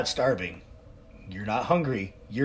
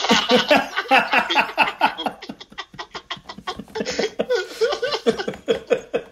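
long high-pitched laughter; 14 semitones higher, 3 dB louder than usual. Real, not acted. Laughing at prep bingo.

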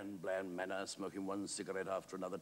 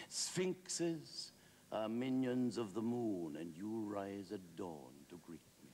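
theatrical, lilting compliment; grand Wilde flourish; 3 semitones higher, slower than usual. Acted. Wilde's flowery compliment, acted.

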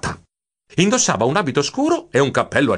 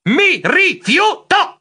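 high, slow, emphatic yell; shrieked refusal; 4 dB louder, much slower than usual. A narrator's reading. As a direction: shriek it word by word. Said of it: "Io strillai". (rifiuto strillato, scandito)

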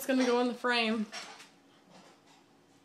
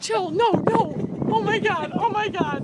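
high loud frightened cries; scared shriek; 6 semitones higher, 5 dB louder than usual. Real, not acted. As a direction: shriek in real fear. Friend climbing over balcony.

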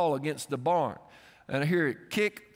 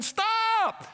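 very high, drawn-out desperate yell; screamed warning. Retold or imitated. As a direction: scream to halt a child in danger. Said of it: "I'm screaming".

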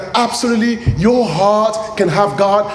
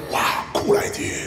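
awed whisper; 7 dB quieter than usual. Acted. Moses voiced in awe.

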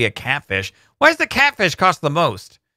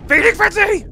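excited shout; 4 dB louder than usual. Acted. Announcing feeding time in game.

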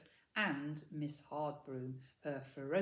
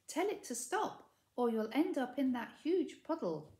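put-on urgent girl's voice; 6 semitones higher, 5 dB louder than usual. A narrator's reading. Mildred urging Maud.

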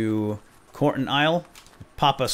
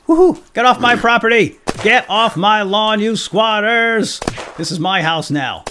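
high, loud cowboy bellow; gleeful shouting; 11 semitones higher, 10 dB louder than usual. Real, not acted. Gamer shooting zombies, yelling at the squatters. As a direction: run them off the lawn at full voice.

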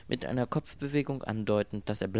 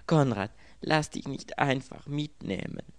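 higher, louder plaintive pleading; wailing plea; 6 semitones higher, 5 dB louder than usual. A narrator's reading. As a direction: plead with him in a wail. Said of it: "jammerte das Mädchen". (jammernd flehend)

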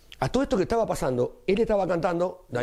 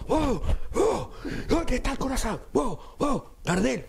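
high frantic yell of a panicked clown; mock panicked high cry; Pinocho yelling; 10 semitones higher, slower than usual. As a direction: wail for the missing heart.

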